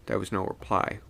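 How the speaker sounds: usual speaking voice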